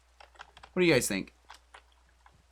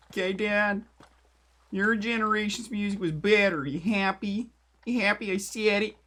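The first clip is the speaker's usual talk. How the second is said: high, loud mock Canadian accent; weird Canadian accent; 8 semitones higher, 6 dB louder than usual. Conceding the eighties music point to Dad, mock Canadian accent. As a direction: put on a Canadian accent, pitched up and pestering. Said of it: "I did a weird Canadian accent".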